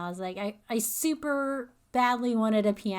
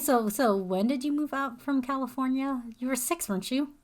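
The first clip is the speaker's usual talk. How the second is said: normal talking voice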